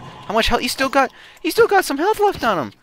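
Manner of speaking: frantic high-pitched alarm. Real, not acted. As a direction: rush it out, voice climbing high. Frantic during fight.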